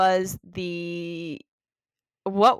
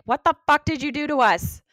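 mock angry birds; 9 semitones higher, 7 dB louder than usual. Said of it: "being like".

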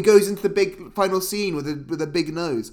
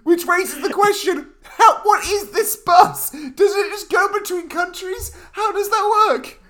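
much higher, slower, louder, incredulous questions; baffled disbelief; 10 semitones higher, 4 dB louder, slower than usual. Real, not acted. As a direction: ask it like it makes no sense at all. Baffled by the bus plot.

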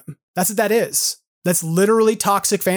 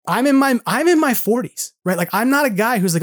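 usual speaking voice

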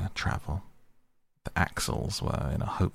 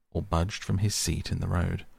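usual speaking voice

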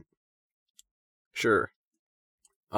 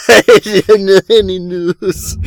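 laughing; 5 semitones higher, 9 dB louder, slower than usual. Real, not acted. Laughing repeat.

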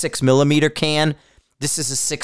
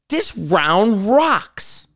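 slow, higher-pitched excited verdict; retold psyched reaction; 5 semitones higher, much slower than usual. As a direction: draw it out, psyched about the result.

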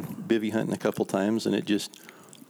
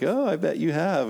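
mock-sarcastic, pitched up; badgering; 8 semitones higher than usual. Young hunter badgering the drunk, sarcastic. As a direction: tease him with fake agreement. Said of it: "kinda badgering him a little bit".

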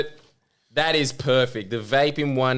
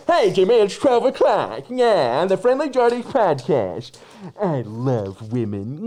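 loud goofy character voice, high; silly put-on voice; 7 semitones higher, 6 dB louder than usual. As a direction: ham it up in a silly voice. Mask voice impression after laughing.

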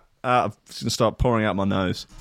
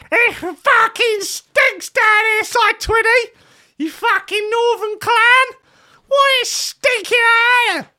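extremely high, slow, loud shrieking woman's voice; screeching mock woman; 7 dB louder, slower than usual. Host imitating a female team member yelling about the smell, shrieked. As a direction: shriek it as a hammed-up furious woman. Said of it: "That's how they speak".

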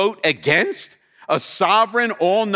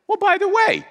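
high-pitched voiced Paul; 13 semitones higher than usual. Voicing Paul, jump in pitch.

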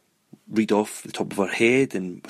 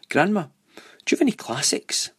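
lightly voiced child, higher pitch; high-pitched retold question to grandma; eager child asking; 8 semitones higher than usual. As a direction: ask grandma like a hopeful kid, voice lifted.

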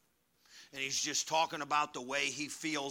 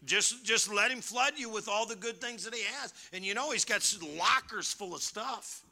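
high, loud preacher's exhortation; fervent preaching; 9 semitones higher, 6 dB louder than usual. Real, not acted. Preaches with raised voice.

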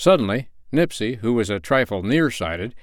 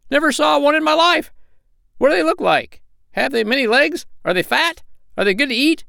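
very high, piping little bird voices; high-pitched, inquisitive young bird; curious hungry sandpipers; 4 dB louder than usual. A narrator's reading. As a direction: fire off eager questions in a squeaky pitch.

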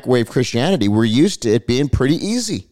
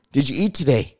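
normal talking voice

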